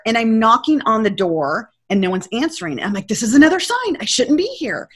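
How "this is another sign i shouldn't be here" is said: higher-pitched worried realization; retold panicking. Traveler locked out at the door, mildly panicking. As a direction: replay mild panic in a raised pitch. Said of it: "I was panicking every step".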